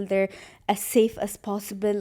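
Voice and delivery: normal talking voice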